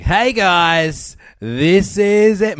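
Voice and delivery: big, drawn-out show-opener holler; loud, high-pitched hammy host greeting; imitating Steele. Podcaster mimicking a friend's show intro, loud and high. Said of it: "start it like Steele does".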